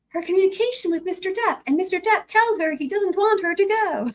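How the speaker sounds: high attorney voice; imitating Elaine, lightly. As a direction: read the line pitched well up. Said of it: "my Elaine voice".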